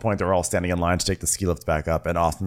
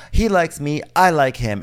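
emphatic insistence; 8 semitones higher, 6 dB louder than usual. Retold or imitated. Emphatic girl quote.

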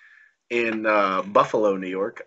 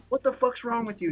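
retold indignation; 11 semitones higher, 5 dB quieter than usual. Retold angry confrontation after the match.

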